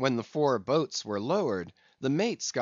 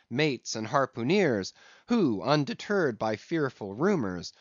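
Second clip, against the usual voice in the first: ordinary delivery, nothing marked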